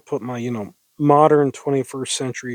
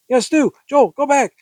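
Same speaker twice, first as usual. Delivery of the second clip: high, loud, urgent command; frantic order; 10 semitones higher, 5 dB louder than usual. Acted. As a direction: send him back in a panicky voice. Heroine orders Joel back, frightened.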